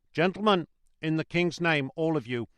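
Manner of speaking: rally them with urgency; urgent appeal. A narrator's reading. Guard adjuring the passengers, loud and urgent.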